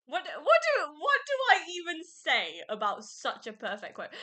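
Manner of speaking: high, loud, laughing gush of delight; excited gushing. Real, not acted. Fan gushing to viewers about a perfect quote, laughing excitedly. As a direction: squeal it out, overwhelmed by the quote.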